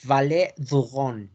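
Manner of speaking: slow Valle du Rhône, throaty R; French Rhône, R from the throat; French pronunciation. Shown as a demonstration. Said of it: "Y el Valle del Ródano".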